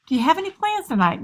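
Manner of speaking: high, loud, chirpy-sweet mother voice; prying mom. Acted. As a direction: play an eager mom quizzing her kid. Acted probing mother questions her teen.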